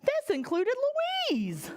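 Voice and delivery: usual speaking voice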